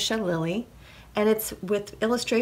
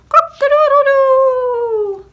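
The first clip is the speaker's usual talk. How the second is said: loud, high, long-held cock-a-doodle-doo; rooster crow; 13 dB louder, slower than usual. A narrator's reading. Rooster waking the farmer at sunrise, crowing loudly. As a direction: crow at full volume like a rooster. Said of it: "the rooster crowed".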